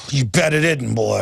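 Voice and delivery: mock sneer in an altered voice; sneering officer. Officer sneering at a complaining citizen, mock sneer. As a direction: imitate a smug cop looking down on you. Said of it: "they just look at you just going".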